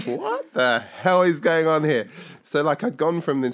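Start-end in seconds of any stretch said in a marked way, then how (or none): 0.1-2.1 loud, slow, high-pitched astonishment; retold disbelief. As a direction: act out a stunned outburst, drawn out.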